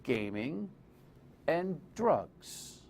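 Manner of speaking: "Gaming", long pause, "and drugs"; slow, deliberate "Gaming ... and DRUGS" with a wide gap; said slowly. Shown as a demonstration. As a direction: spell it out slowly, GAMING left hanging before DRUGS. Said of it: "Say it slowly to yourself".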